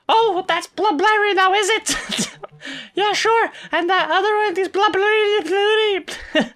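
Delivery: high, loud sarcastic mimicry; mocking rant. Collector mocking a wrong guess, high sarcastic outburst.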